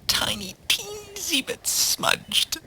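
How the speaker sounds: tiny high squeak, drawn out; teensy voice. A narrator's reading. Narrator on Debbie's barely smudged mascara, tiny high voice. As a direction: shrink to a slow, soft squeak.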